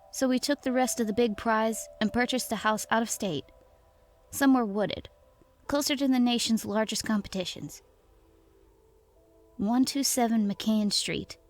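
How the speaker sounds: ordinary delivery, nothing marked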